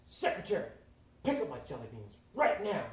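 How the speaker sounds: loud, barked order to a secretary; acted angry summons; furious boss. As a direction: bellow it like an enraged boss. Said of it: "furious. He's so upset".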